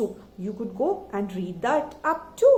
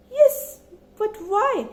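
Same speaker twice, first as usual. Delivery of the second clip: sudden higher, louder surprised reply; surprised granny; 10 semitones higher, 4 dB louder than usual. A narrator's reading. Old granny startled by the soldier's question. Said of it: "The granny was really surprised".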